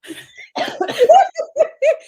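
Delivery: loud, high-pitched cackling laugh; real burst of laughter. Real, not acted. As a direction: crack up at your own joke. Cracks up over her own joke.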